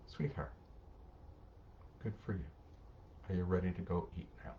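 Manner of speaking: put-on soft, tender praise to a calmed child; tender parent. Parent praising a child who finally calmed down, soft and tender. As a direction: coax gently, like a calm parent.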